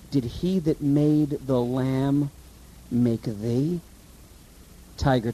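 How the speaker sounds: a quiet, reverent poem reading; poem recital. Acted.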